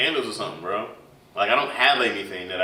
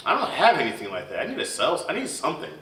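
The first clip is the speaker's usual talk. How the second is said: ordinary talk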